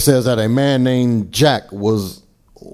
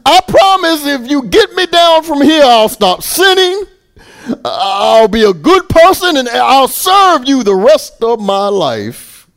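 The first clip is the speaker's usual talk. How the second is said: high, loud bargaining plea; desperate man bargaining with God for rescue; crying out; 12 semitones higher, 6 dB louder than usual. Acted. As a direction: promise anything to be saved. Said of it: "Jack cries out in excitement".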